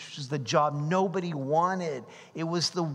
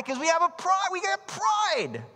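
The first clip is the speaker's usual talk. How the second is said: proclaimed; 13 semitones higher than usual. Real, not acted. Preacher proclaiming pride.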